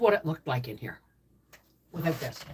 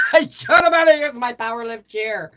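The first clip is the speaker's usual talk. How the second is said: laughing; 7 semitones higher, 8 dB louder than usual. Real, not acted. Laughing repeat.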